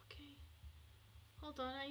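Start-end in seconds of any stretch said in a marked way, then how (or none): 0.0-0.4 quick hushed whisper; rushed sneaky whisper. Real, not acted. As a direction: whisper fast, don't get caught. Sneaking to goal.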